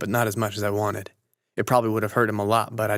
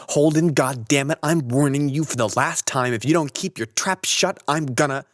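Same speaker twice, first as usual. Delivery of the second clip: angry last warning; 5 semitones higher than usual. A narrator's reading. Stradlater warning Holden for the last time, angry shout. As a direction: warn him one last time, shouting.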